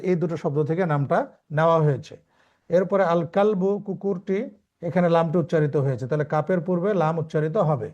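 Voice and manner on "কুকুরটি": normal talking voice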